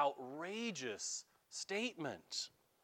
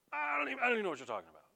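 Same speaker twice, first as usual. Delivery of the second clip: rushed, flustered denial, much faster; defensive; 7 semitones higher, 3 dB louder, much faster than usual. Acted. Peter brushing off his accusers, fast and defensive. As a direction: blurt it out fast, caught out. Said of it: "now he's gotten really defensive".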